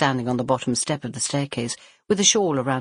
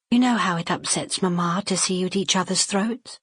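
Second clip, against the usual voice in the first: gentle warning; 6 semitones higher than usual. Acted. Elder sister warning.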